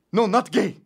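put-on high, loud accented denial; outraged foreign cab driver; indignant cabbie. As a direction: shout it down, deeply offended.

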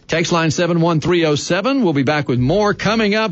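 upbeat radio host read; radio announcer. Acted. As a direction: sell the station break.

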